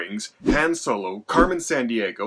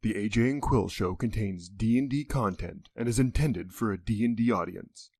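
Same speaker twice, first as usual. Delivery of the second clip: announcer voice; 3 semitones lower, 5 dB quieter than usual. Acted. Spoken content disclaimer intro.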